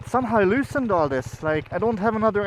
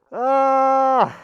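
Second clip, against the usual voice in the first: startled cry; 8 semitones higher, 7 dB louder than usual. Real, not acted. Discovers missing plate.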